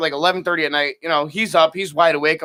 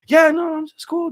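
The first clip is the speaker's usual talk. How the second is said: high, slow forced casualness hiding fear; faking calm; 11 semitones higher, slower than usual. Retold or imitated. On the phone to his girlfriend, masking fear with slow forced calm. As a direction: pretend it's fine while scared stiff. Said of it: "trying to hide it so the ghosts don't".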